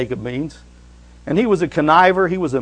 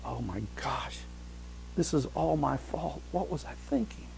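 much softer, hushed self-blame; guilty whisper; 13 dB quieter than usual. Pretend. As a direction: say it under your breath in dismay. Imagined guilty thought.

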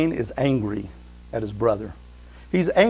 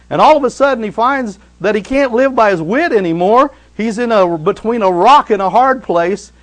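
higher, louder, building intensity; rising preaching; 7 semitones higher, 9 dB louder than usual. Acted. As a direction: climb to a shouting peak.

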